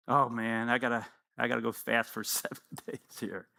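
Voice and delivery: low, soft reluctant grumble with a laugh; grumbling dieter. Retold or imitated. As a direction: mutter it like you dread the fast. Dieter groaning about fasting.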